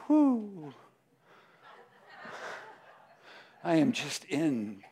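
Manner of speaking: mock pretend ecstasy. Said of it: "You can pretend".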